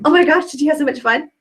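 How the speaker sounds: acted excitement. Consultant getting the customer to imitate her, excited. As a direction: ask it with infectious excitement. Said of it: "So if I'm excited, I'm like".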